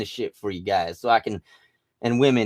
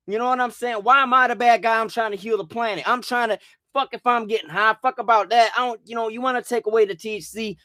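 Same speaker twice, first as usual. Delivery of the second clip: high-pitched, exasperated protest; indignant rant; 6 semitones higher than usual. Real, not acted. Ranter defending cannabis planting to viewers, indignant. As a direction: argue back, worked up and aggrieved.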